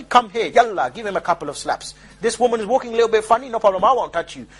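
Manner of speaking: normal talking voice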